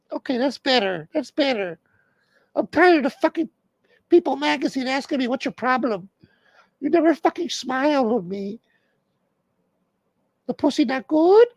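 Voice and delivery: hammed-up girlfriend nagging in falsetto; wife's voice. Comic voices [name] nagging [name], high mock girlfriend voice.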